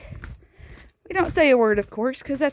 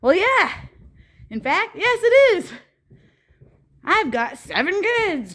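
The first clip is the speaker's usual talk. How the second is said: higher, laughing boss voice, lightly put on; imitating Kevin; 6 semitones higher than usual. Boss teasing about winter alone, higher mock voice. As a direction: play the amused boss owning up. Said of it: "he goes".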